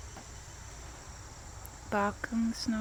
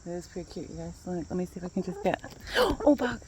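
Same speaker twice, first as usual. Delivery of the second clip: ordinary talk, nothing marked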